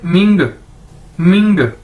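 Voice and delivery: मिंग said singly as "ming"; model pronunciation. Shown as a demonstration. Teacher showing how M-I-N-G is read, model pronunciation. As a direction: give मिंग as an isolated, firm syllable. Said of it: "इसको कैसे पढ़ेंगे?".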